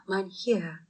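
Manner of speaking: soft husky plea; husky, whispered begging; husky pleading. Acted. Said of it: "pleaded Hans in a husky voice".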